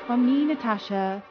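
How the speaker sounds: plain reading voice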